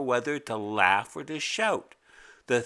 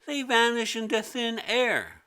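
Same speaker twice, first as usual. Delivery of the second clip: put-on much higher, protesting kid; innocent girl voice; 11 semitones higher than usual. A narrator's reading. Mimi insisting to the teacher it's not her fault, high. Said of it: "Mimi continues".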